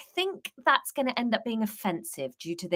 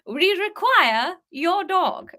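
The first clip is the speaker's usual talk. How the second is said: posh English Queen; 8 semitones higher, 3 dB louder, slower than usual. Shown as a demonstration. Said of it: "I even wrote it all in we".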